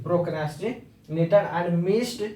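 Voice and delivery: procrastinated and missed said very slowly, word by word; very slow dictation. Shown as a demonstration. Teacher reading procrastinated and missed to students, slowly. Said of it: "आप इस तरीके से use करते हैं".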